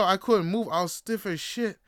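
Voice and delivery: ordinary talk, nothing marked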